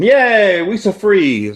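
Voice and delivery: high, loud sing-song yay; mock cheer. Viewer mock-cheering the freed people on screen, high and loud. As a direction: cheer like a kid for the rescued crowd.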